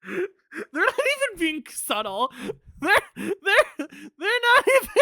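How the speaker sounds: high-pitched, loud, stumbling disbelief; incredulous. Real, not acted. Player reacts to an unsubtle villain, high spluttering disbelief. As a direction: splutter it, voice climbing.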